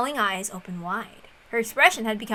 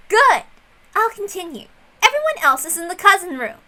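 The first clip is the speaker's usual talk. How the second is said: high, bright and pushing on; eager higher voice; 8 semitones higher, 6 dB louder than usual. Acted. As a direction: press ahead with glee. Erica eagerly listing again.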